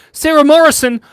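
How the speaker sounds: name called out high and loud; excited shout-out. Real, not acted. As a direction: call the donor's name with a loud, happy cheer.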